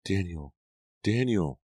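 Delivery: light, drawn-out calling voice. A narrator's reading. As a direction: call a name from afar, rising on the repeat. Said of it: "a voice floated toward him, calling him".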